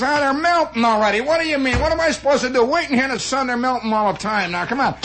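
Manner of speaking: high-pitched mock indignation; griping slob customer. Slob complains about melting cones, indignant griping. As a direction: whine and bluster like a pushy customer. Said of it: "he's a real slob".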